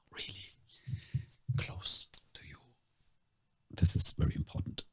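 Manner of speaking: near-whisper right into the ear; very hushed, close-up voice. Shown as a demonstration. Host telling listeners why headphones are needed, very hushed. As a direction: drop to a tiny, breath-level murmur.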